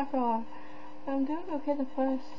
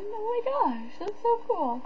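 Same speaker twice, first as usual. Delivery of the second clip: excited; 10 semitones higher than usual. Real, not acted. Thrilled by game surprise.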